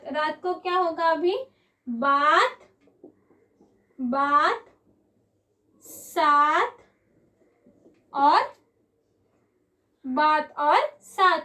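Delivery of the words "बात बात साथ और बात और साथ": बात, बात, साथ each set apart with pauses and stressed, the last line slow; rhyme demo. Shown as a demonstration. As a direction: lean on the ending आत in बात and साथ. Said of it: "रात ये pronounce करें".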